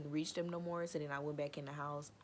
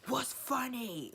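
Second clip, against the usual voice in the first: high, slow, demanding "what's funny"; confrontational snap; 8 semitones higher, 4 dB louder, slower than usual. Retold or imitated. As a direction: challenge her, dead serious and slow. High confrontation with laughing sister.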